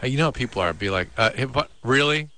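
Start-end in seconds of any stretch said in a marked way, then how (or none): 1.8-2.3 higher, put-on questioning; mock disbelief. Co-host as a partner negotiating over the safe word, mock disbelief.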